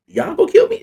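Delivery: high, loud acted question; mock-alarmed high-pitched question; incredulous question. Samson questions his captors, acted.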